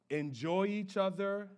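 ordinary talk, nothing marked

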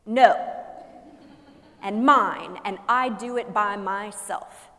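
put-on loud, slow, stubborn toddler's no; a preacher playing a defiant little child; toddler protests. To the congregation, mimicking a toddler's tantrum, loud and slow. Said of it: "begins to speak ... say things like".

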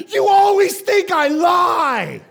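high, drawn-out wailing shout; screaming child imitation. Daughter accusing dad through tears, mock screaming. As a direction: scream it like a wronged kid in tears. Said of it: "she's crying and tears are everywhere and she's just screaming".

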